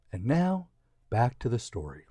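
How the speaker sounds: ordinary delivery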